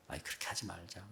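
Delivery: very soft, low, quick aside; gentle dissuading. Retold or imitated. Believer to friends badmouthing others, softly dissuading. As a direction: quietly wave the gossip away.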